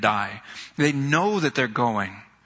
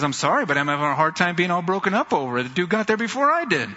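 quoting Bobby; 5 semitones higher, faster than usual. Retold or imitated. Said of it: "He goes".

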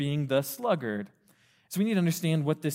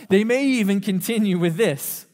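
ordinary delivery

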